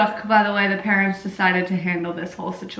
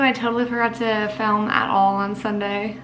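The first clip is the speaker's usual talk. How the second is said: normal talking voice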